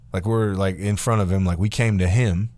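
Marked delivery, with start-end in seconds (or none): none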